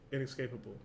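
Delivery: ordinary delivery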